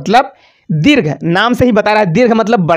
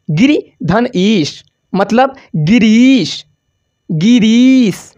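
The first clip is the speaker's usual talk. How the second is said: slow spelled-out demo; much slower than usual. Shown as a demonstration. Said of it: "teacher spells out the word".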